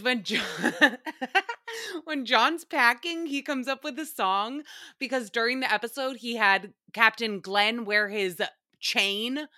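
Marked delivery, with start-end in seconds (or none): none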